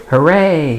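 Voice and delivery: loud, high hooray shout; narrated shout, pitch and volume up; storybook cheer. A narrator's reading. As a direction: cheer it out for a young listener. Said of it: "shouted Timothy from his seat".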